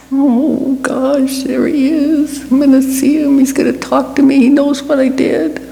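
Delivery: high, nervous inner voice of Peter; anxious Peter. Acted. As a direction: fret aloud, dreading the meeting. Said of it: "Peter was filled with angst".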